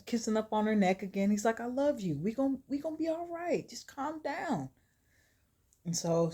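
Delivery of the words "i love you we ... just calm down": soft, higher-pitched reassurance; hushed, tender acted comfort, slowing at the end; calming. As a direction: soothe a scared lover gently. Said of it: "trying to calm her down".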